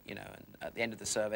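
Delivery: ordinary delivery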